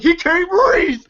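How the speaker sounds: high, strained imitation of a panicked cry; mock alarm. Film fan acting Craig pleading for choked Day Day, high mock alarm. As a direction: cry out in exaggerated alarm for a friend.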